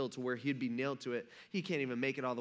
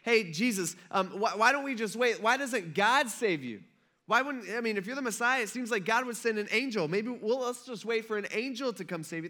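higher, louder sarcastic taunt; mocking taunt; 8 semitones higher, 4 dB louder than usual. Crowd taunting Jesus to wait for an angel, higher sarcastic mock. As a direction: needle him with fake patience. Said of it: "Some people were saying".

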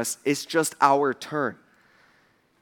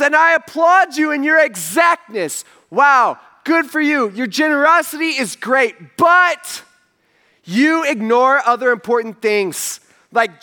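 loud, slow, high rebuke hurled at the crowd; preaching at full force; 7 semitones higher, 5 dB louder, slower than usual. Acted. Preacher voicing Jesus rebuking the religious leaders, loud and slow. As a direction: thunder it out like a prophet scolding.

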